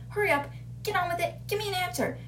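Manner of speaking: quick, high, impatient badgering; mock headmistress impatience; impatient demand. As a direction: hurry a pupil along, acted bossy and fast. Headmistress hurries flustered pupil.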